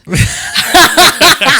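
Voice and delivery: high, loud burst of laughter. Real, not acted. Reacting to joke about northern soul.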